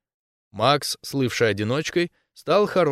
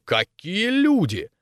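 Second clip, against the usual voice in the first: high, drawn-out mock exclamation; feigned surprise; 9 semitones higher, slower than usual. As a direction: pretend delighted surprise, stretching it out slowly. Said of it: "изобразил удивление и воскликнул". (притворно удивлённый возглас)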